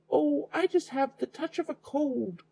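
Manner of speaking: very high squeak of a fake granny; wolf in disguise excusing his voice, high squeak; squeaky wolf. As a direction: squeak it out in falsetto. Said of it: "squeaked the old wolf".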